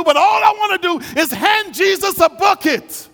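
impassioned preacher climbing to a shout; full-force preaching. Real, not acted. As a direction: preach it high and urgent, building in intensity.

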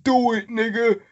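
high, loud [name] impression; rapper imitation.

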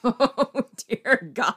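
high, loud laughing groan of words; laughing dismay. Real, not acted. Host reacts to co-host's booming voice, laughing dismay. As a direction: laugh through the exclamation.

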